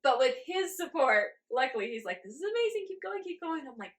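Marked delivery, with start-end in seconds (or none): 2.2-3.7 high, mock excited cheer; imitating eager husband. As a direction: play the husband cheering her on, pitched high.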